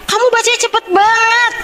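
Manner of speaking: high-pitched mock disbelief; retold amazement. Husband insists wife reads very fast, retold amazement. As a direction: imitate an astonished husband, voice pitched right up.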